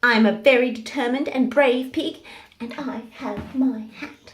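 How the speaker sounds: loud, proud piglet; proud little pig. Acted.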